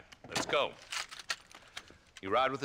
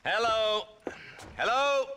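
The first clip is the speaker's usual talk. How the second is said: loud, high call down the line; shouting into phone; 8 dB louder, slower than usual. Acted. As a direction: yell into the receiver. Shouting into a new telephone.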